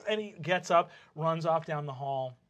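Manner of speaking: usual speaking voice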